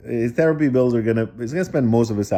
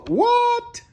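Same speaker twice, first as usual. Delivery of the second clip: high, startled what; shocked what. Acted. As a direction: gasp it out in disbelief. Reader reacts to the mess.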